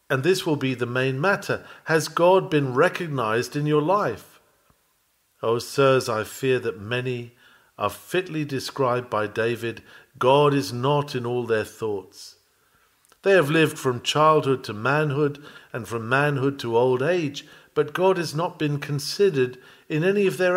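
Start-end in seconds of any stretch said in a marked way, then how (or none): none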